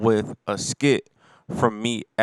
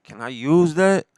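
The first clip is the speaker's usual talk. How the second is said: a little higher and louder, jumping at the idea; eager ask; 6 semitones higher, 5 dB louder than usual. Retold or imitated. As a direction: pop the question like you just got an idea. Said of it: "I was like".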